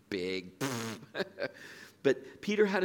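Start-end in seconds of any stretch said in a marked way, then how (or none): 0.1-0.5 teasing Minnesota vowel in "big"; Minnesota accent. Retold or imitated. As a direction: mimic a Minnesotan saying bag as "big". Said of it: "They have a specific accent".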